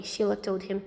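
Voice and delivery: usual speaking voice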